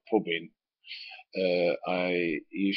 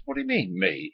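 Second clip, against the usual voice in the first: indignant surprise; 12 semitones higher than usual. Retold or imitated. Accused by boss of jinxing.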